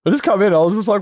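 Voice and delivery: ordinary talk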